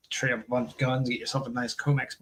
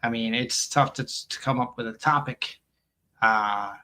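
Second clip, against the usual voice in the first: usual speaking voice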